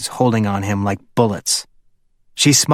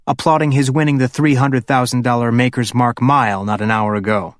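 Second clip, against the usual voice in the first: usual speaking voice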